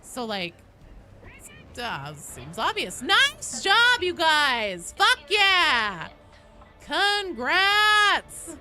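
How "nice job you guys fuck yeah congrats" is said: loud, high congratulatory shout; real celebratory yell; excited cheering. Real, not acted. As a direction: whoop for the team, drawing out the big words. Cheering players on their win.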